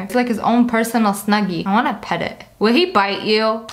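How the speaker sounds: ordinary talk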